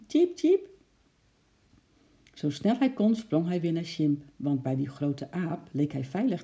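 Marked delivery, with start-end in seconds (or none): 0.1-0.7 very high, louder chirps; squirrel's squeak. A narrator's reading. Eki fearing a magpie, high squeaky cheeping. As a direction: squeak sharply like a tiny squirrel.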